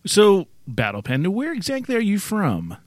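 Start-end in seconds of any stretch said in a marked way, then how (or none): none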